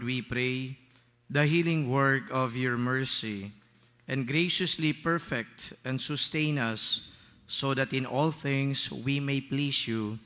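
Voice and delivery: prayer. Acted. Priest leads opening prayer.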